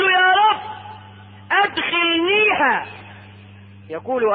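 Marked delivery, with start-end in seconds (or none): 0.0-2.9 high, drawn-out entreaty; pleading. Acted. Man at the gate of Paradise begging God, pleading. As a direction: stretch it out, begging to get in.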